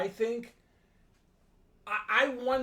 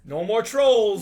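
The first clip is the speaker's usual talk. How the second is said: excited cheer; 2 semitones higher, 11 dB louder, slower than usual. Real, not acted. Cheering an idea in discussion.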